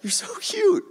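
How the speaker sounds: high, chuckling disbelief; amused God. Acted. God to believers who think it depends on them, laughing coo. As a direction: tease them as if they were adorable toddlers.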